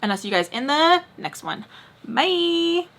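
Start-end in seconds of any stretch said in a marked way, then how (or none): 2.1-2.9 high sing-song sign-off; chirpy high held note; millennial bye. Real, not acted. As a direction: draw it out high like hanging up. Performs her signature millennial sign-off.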